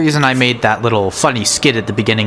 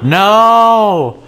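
shocked dismay; 9 semitones higher, much slower than usual. Real, not acted. Discovers damaged house.

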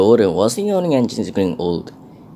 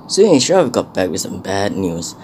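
ordinary delivery, nothing marked

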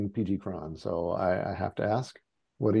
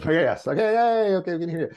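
excited; 10 semitones higher, 4 dB louder than usual. Real, not acted. Host relieved the audio works.

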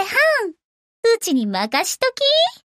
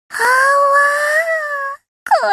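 long high squeal of joy, stretched out slowly; a drawn-out happy wail as new power surges in; delighted cry; 6 semitones higher, much slower than usual. Acted. Ship girl squealing with joy at her upgrade.

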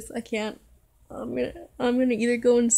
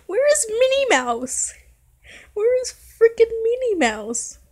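high, loud, wailing questions; distressed outcry; 10 semitones higher, 6 dB louder than usual. Real, not acted. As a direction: demand answers in rising distress. Distressed demands about the destroyed town.

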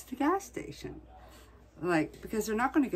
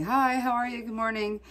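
bright, higher greeting to customers; cheery greeting; 7 semitones higher than usual. Retold or imitated. Clerk greeting morning customers, cheerful and higher. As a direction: greet them like a friendly clerk. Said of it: "They never heard anybody say".

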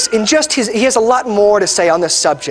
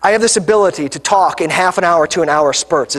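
ordinary delivery

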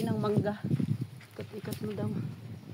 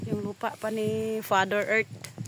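usual speaking voice

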